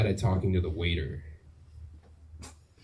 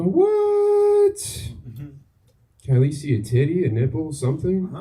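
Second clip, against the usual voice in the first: excited; 8 semitones higher, 4 dB louder than usual. Retold or imitated. Retold closet game plea.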